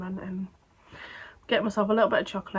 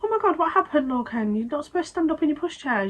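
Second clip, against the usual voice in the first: higher, mildly alarmed quote; raised pitch, mock-worried questioning; retold alarm; 7 semitones higher, 3 dB louder than usual. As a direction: imitate a parent's startled fretting. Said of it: "like, a panic".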